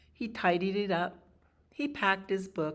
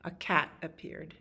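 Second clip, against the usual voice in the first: ordinary delivery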